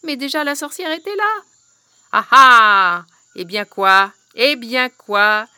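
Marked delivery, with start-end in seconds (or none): 2.1-5.5 loud witch cackle. Acted. Witch surprises them, cackling.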